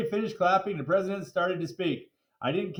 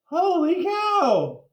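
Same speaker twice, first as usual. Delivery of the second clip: high, loud, slow gasp of wonder; amazed exclamation; 8 semitones higher, 5 dB louder, much slower than usual. Acted. Kid sees his old music teacher in a cape, amazed. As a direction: stretch out the amazement.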